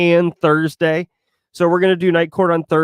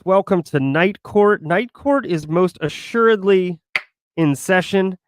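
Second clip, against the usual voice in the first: grand, slowed host welcome; show host announcement; 5 semitones higher than usual. Acted. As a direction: open the show like a courtroom announcer.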